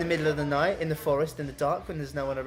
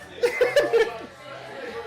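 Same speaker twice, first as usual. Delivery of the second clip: high wheezing laughter. Real, not acted. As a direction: cackle breathlessly. Speaker bursts out laughing.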